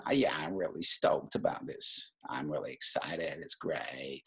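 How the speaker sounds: low, creaky California Valley Speak. Shown as a demonstration. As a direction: do a Californian Valley drawl in fry. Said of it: "Valley Speak coming from California".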